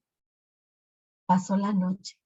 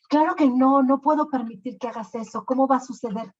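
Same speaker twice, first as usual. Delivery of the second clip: emphatic refusal; 5 semitones higher, 4 dB louder, faster than usual. Acted. King refuses the marriage.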